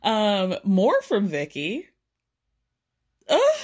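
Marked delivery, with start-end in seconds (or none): 3.3-3.6 high loud ugh of glee; excited squeal. Real, not acted. Vlogger can't believe the gossip, squealing ugh. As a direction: squeal it out in disbelief.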